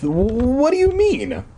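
high, slow flustered protest; sputtering. Retold or imitated. [name] protesting to Judith, flustered and high. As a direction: caught off guard and sputtering. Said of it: "I sputtered".

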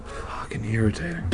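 whispered curse, real annoyance; very soft, breath-only grumble; irritated mutter. Real, not acted. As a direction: hiss it under the breath.

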